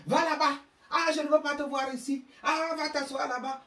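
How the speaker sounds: put-on high, loud scolding of a child like a mother chasing him off; harsh shooing. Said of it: "Il faut alors les voir comment".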